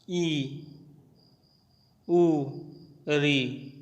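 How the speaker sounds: ordinary delivery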